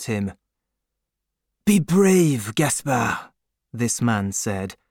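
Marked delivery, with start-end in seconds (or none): 1.6-3.3 comforting neighbour. A narrator's reading. Comforting friend voiced.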